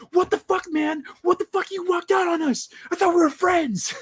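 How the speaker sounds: high-pitched mock outrage at the friend who ditched him; freaking-out cop. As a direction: play the betrayed cop losing it. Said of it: "He's like".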